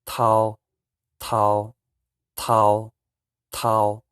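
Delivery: "Tao" with tongue between the teeth, loud and clear; teacher drilling "tao" for the class; Hmong tao. Shown as a demonstration. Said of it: "put your tongue in the middle of your teeth and say".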